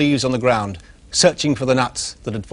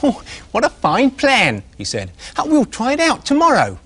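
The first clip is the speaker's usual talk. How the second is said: little pig delighted with the ladybird's plan; 10 semitones higher than usual. Acted. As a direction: savour the clever plan with glee. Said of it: "began to laugh until tears".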